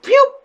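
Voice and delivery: high, loud pew sound effect; cartoon pew noise, high and loud; mock pew. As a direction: zap it out like a laser noise. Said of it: "And they're like".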